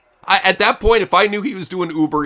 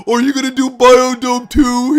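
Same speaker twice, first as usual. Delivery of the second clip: mock fan; 9 semitones higher, 7 dB louder than usual. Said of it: "And he's like".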